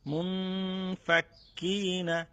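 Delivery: মুনফাক্কিনা with the ক্ক held double, very slow; doubled kaf. Shown as a demonstration. Teacher drilling the tashdid for students, slow and precise. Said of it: "কাফের উপরে হচ্ছে দে-, তাজদিদ".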